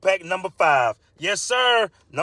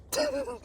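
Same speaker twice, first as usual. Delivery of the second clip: squeaky high giggle; high laugh; 9 dB quieter than usual. Real, not acted. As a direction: crack up in falsetto. Laughs at own punchline.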